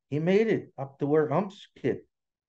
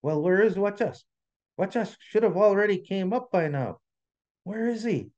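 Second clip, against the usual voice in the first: high, anxious storybook questioning; pitched-up worry, lightly acted; worried; 7 semitones higher than usual. A narrator's reading. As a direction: wonder with rising concern. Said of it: "their laughter turned to worry".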